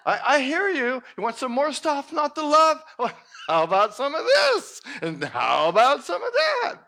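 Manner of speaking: loud, high mocking universe voice; booming teasing universe. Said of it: "the universe says".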